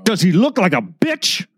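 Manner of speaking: a light imitation of a furious gangster; Samuel L. Jackson. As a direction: bark the quote, playing a tough hitman. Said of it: "favorite Samuel L. Jackson quote".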